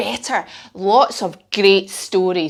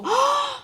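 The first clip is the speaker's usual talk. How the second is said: fed up horse blow; 6 dB quieter than usual. Shown as a demonstration. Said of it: "put our head down and go".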